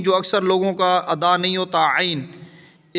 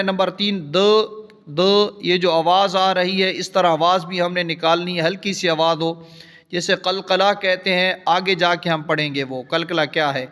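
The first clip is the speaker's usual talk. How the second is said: normal talking voice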